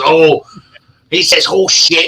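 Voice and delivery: mock-angry parroting; teasing mimicry. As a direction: mimic him mocking "horse shit". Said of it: "And he goes".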